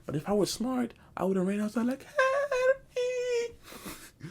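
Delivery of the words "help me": much higher wailing cry; hammed-up pitiful wail; mock crying for help.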